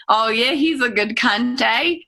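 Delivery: put-on high New Zealand accent, lifting at the end. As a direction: chat in a New Zealand accent with a rising final inflection. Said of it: "in a New Zealand accent".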